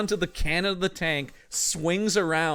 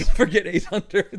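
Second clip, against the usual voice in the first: laughing repeat; 5 semitones higher, 7 dB louder than usual. Real, not acted.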